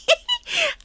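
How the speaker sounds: high burst of laughter; short high amused laugh. Acted. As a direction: laugh out in disbelief. Laughs at ex in-law remark.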